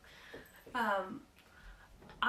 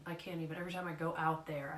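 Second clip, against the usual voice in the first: ordinary talk, nothing marked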